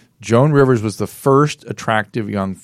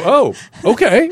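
stunned reply; 13 semitones higher, 5 dB louder, slower than usual. Retold or imitated. His stunned reply to Roseanne's explanation.

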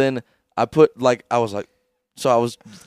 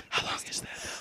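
soft whispered question; whispered follow-up to girlfriend; 14 dB quieter, faster than usual. Retold or imitated. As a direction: breathe it quietly. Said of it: "I'm like".

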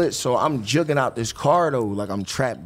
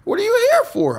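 cheerful judge. Retold or imitated. The judge turning round to greet him, a high cheery imitation. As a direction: play the beaming judge catching up.

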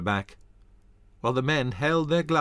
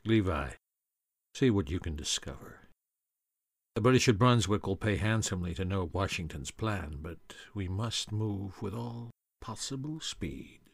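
quiet urgent order; 5 dB quieter than usual. Acted. Moses to Levi, quiet urgent instruction. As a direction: hush the orders to your brother.

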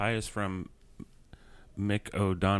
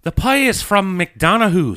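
high, loud, slow Irish accent; showing up the others with an Irish accent; 10 semitones higher, 12 dB louder than usual. Shown as a demonstration. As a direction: deliver it with a confident broad Irish lilt. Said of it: "now [name], you do it".

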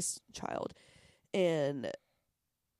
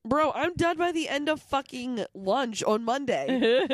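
emphatic; 7 semitones higher, 6 dB louder than usual. Real, not acted. Exaggerated complaint about work week.

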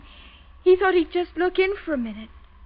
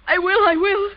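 louder frantic insistence; hysterical; 3 semitones higher, 6 dB louder than usual. Acted. Daughter panicking at her father, hysterical. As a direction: blurt it out in panic.

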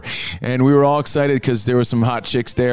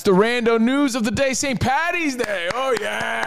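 sing-song; 10 semitones higher than usual. Acted. Playful sign-off.

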